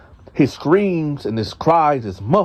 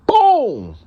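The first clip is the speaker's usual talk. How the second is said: imitated slam; 11 semitones higher, 4 dB louder than usual. Slamming cellmate onto the floor.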